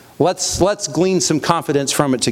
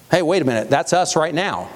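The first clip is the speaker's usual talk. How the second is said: ordinary talk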